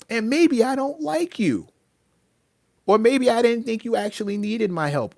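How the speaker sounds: high, heated telling-off; indignant retort. Acted. Answering people who demand his help, indignant. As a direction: fire back with pitch jumping up.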